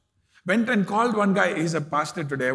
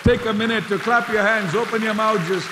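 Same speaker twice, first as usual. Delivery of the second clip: louder, higher preaching that swells to a long Hallelujah; fervent call to praise; 4 semitones higher, 5 dB louder than usual. Acted. Preacher urging the congregation to clap and praise, loud and fervent. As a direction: rouse the room to worship.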